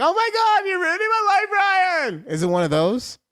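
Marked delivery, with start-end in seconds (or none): 0.0-2.2 high-pitched squealed protest; hammed-up teenage sister wail; mock whiny little sister. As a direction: squeal it like an embarrassed kid sister. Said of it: "then you're just like".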